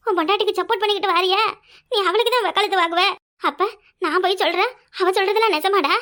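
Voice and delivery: ordinary delivery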